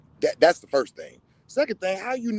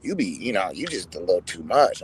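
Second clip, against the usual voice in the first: lower, mock-hesitant critic voice; hesitant critic impression in a lower voice; imitating a critic; 9 semitones lower than usual. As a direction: play a fumbling critic, voice dropped low. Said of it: "And other people like".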